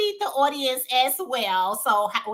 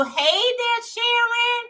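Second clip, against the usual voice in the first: sing-song greeting; 11 semitones higher, slower than usual. Retold or imitated. Reading a chat greeting aloud.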